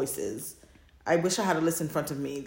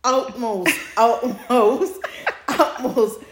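louder, higher, slow and laughing; laughing through it; 7 semitones higher, 8 dB louder, much slower than usual. Real, not acted. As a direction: give the warning while cracking up. Laughing warning about spicy scenes.

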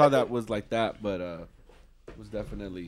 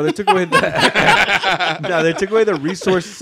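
laughing; 6 semitones higher, 6 dB louder, slower than usual. Real, not acted. Laughing while losing his words.

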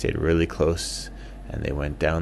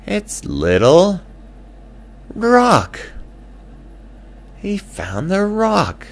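excited reveal; 7 dB louder, slower than usual. A narrator's reading. Excited discovery.

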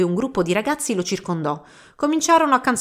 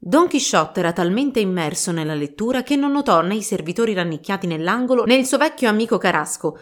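ordinary delivery, nothing marked

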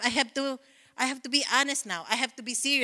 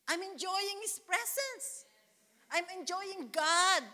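slow, savouring; 6 semitones higher, 6 dB quieter, slower than usual. Real, not acted. Pastor savouring God's presence.

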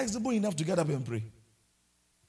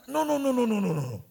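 mock protest; 4 semitones higher, 5 dB louder than usual. Mocking a complaining believer.

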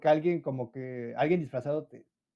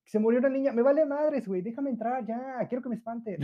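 higher, impatient whining to get in; impatient bored kid; 6 semitones higher than usual. Retold or imitated. Bored teen telling the host to hurry, impatient whine. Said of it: "ya era como de".

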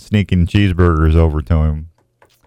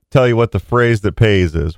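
ordinary talk, nothing marked

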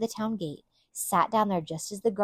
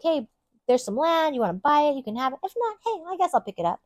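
offhand and breezy, pitched up high; nonchalant; 8 semitones higher, 4 dB louder than usual. Retold or imitated. Boaz casually offering the land to the redeemer, breezy and offhand. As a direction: toss off the offer like it's nothing. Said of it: "just nonchalantly he's like".

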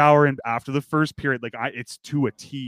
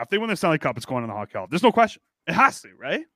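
ordinary delivery

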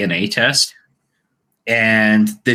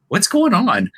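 surprised; 10 semitones higher than usual. Retold or imitated. Police voiced in story.

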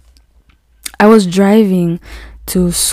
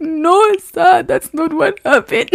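very high, drawn-out protest; mock wail; 10 semitones higher, slower than usual. Crying driver correcting the panicked policeman, mock wail. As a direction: imitate a tearful denial, stretched out high. Retold tearful reply to policeman.

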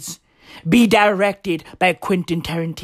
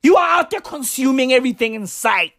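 accusing shout; 5 semitones higher than usual. Real, not acted. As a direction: yell it as an accusation. Accusing rant at scammers.

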